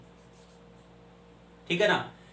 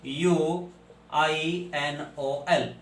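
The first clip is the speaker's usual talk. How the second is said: spelled out; much slower than usual. Shown as a demonstration. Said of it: "क्विनोल हो गया".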